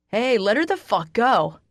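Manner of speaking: angry loud demand; bellow. Acted. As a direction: bellow across the street. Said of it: "Jack bellows".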